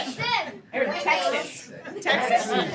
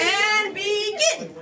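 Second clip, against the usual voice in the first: drawn-out call; 5 semitones higher, much slower than usual. Real, not acted. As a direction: stretch it out to launch the round. Host launches the round, calling out.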